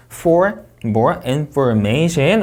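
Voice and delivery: very slow, INFORMATION lifted at the end; unfinished phrase, INFORMATION going up; rising intonation. Shown as a demonstration. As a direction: draw it out and raise INFORMATION. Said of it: "올려 읽고".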